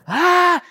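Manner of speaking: high, loud startled cry; panicked yelp. Retold or imitated. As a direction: yelp as the doors close. Elevator closing on them.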